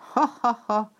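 loud, high clown cackle; bursting laughter. Acted. Arlecchino bursting out laughing at Piero's sweetheart. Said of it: "tör ki ismét kacagásban Arlecchino".

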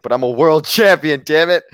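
loud, high mocking whine of a bragger; mocking whiny boast. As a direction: parody a spoiled champion yelling his claim. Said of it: "and you're like".

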